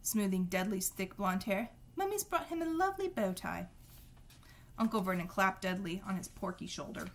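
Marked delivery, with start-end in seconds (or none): 2.0-3.7 high, doting mother's coo; sugary high voice for the darling son; Aunt Petunia's voice. A narrator's reading. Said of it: "said Aunt Petunia".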